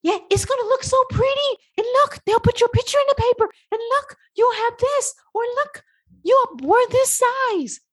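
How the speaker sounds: very high, fast pleading sales pitch; coaxing a toddler. Retold or imitated. You coaxing your stubborn brain like a toddler, high and fast. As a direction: wheedle like coaxing a child. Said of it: "You're like".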